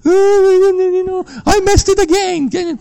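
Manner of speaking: high mocking whine of a self-pitying believer; high mock sinner's whine; mock whiny confession. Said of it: "not confession of".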